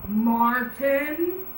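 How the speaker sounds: high, rising call of the name; a startled father shouting; angry yell. Acted. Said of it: "yelled his dad".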